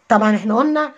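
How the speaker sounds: normal talking voice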